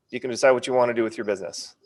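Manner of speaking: normal talking voice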